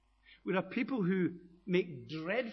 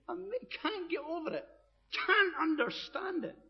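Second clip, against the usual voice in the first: astonished; 12 semitones higher than usual. Retold or imitated. Voicing Jesus's amazement.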